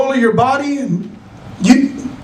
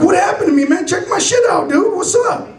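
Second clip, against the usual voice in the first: high, urgent demand; acted frantic patient; comic panic; 6 semitones higher than usual. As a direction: plead for answers in mock alarm. Frantic patient confronts nonchalant doctor.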